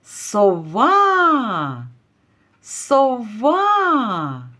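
сова with a long, falling final А; calling out. Shown as a demonstration. Teacher calls the owl to find the stress, drawing out сова's А. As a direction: call сова, stretching the last syllable. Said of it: "Кличемо сову".

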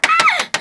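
very high, loud shriek of joy; delighted scream. Acted. Girl opens mom's surprise, joyful shriek.

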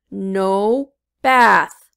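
loud stubborn monster; monster refusing. A narrator's reading. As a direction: growl it like a grumpy beast. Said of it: "said the monster".

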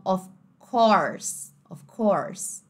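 slow "of course" with clear R; American R. Shown as a demonstration. Teacher showing American, R-ful "of course". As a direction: curl the R in "course". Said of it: "no inglês americano".

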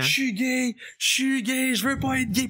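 high crying wail, voice cracking up; high-pitched sobbing lament; mock sobbing wail. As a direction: wail it like a panicked teen in tears. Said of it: "je pars à brailler".

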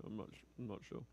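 ordinary talk

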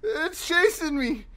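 shrieking in real panic; high terrified screams; scared horror game streamer. Real, not acted. Chased by monster.

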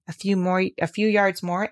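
usual speaking voice

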